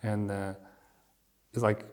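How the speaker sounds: usual speaking voice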